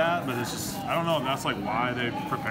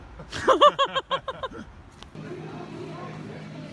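loud high laugh; 7 dB louder than usual. Real, not acted. Guy laughs after joke.

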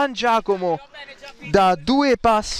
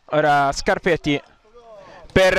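ordinary talk

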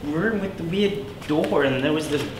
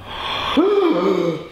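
gasp of shock; 5 semitones higher than usual. Acted. Sees friend has aged.

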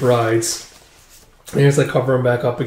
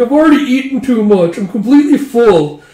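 high, loud acted complaint; loud protest; 9 semitones higher, 5 dB louder than usual. Guest protests being overfull.

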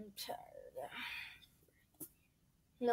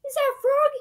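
surprised squeak; 5 dB louder, faster than usual. Acted. Surprised character.

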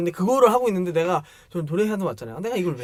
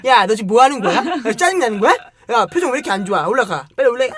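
louder, nagging upperclassman tirade; scolding senior; 5 semitones higher, 8 dB louder than usual. Retold or imitated. Female senior scolds a junior for a sour face, imitated loud scolding. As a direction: chew out a sulky junior. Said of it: "여자 선배들이 그렇게 지랄해".

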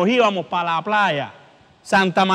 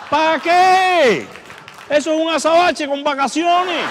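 mock outrage; 5 semitones higher than usual. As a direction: exclaim it in mock disbelief, pitch raised. Mock outrage over ugly babies' amulets.

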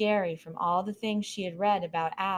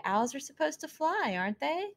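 higher, lightly voiced mouse girl; curious little mouse; 8 semitones higher than usual. Mouse Cleopatra quizzing the fallen owl, lightly higher voice. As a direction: poke at it with questions.

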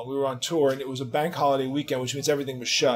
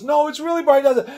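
much higher, loud frantic protest; desperate insisting; 12 semitones higher, 6 dB louder than usual. Retold or imitated. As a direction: beg her to believe you. Mark pleading on the phone.

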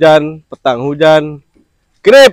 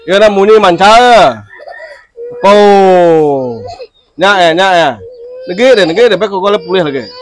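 higher, louder sing-song coaxing voice; coaxing a toddler; 7 semitones higher, 5 dB louder than usual. Acted. Parent coaxing a crying child to repeat words, high sing-song coaxing. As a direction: coax the little one along, voice lifted.

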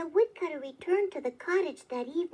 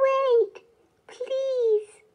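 high, pleading little elf voice; small elf calling out, much higher, to halt; 10 semitones higher, 5 dB louder than usual. A narrator's reading. Small voice begging a woodcutter to stop, high and pleading. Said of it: "he heard a small voice say".

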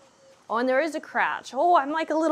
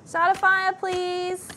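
high, insistent plea; pleading; 7 semitones higher, 5 dB louder, slower than usual. Real, not acted. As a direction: beg the game in a raised, held-up pitch. Pleading with the game.

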